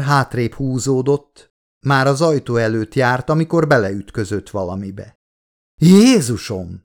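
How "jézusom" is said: slow, loud startled exclamation; frightened loud gasp of a word; startled cry. A narrator's reading. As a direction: cry out at the bump in the dark. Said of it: "kiáltott fel".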